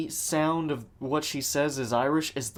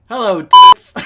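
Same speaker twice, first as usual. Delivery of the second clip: much louder, higher mock yell; loud catcall; 10 semitones higher, 22 dB louder than usual. Someone behind reacting to her costume, loud mock catcall. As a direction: bellow it like a crude heckler.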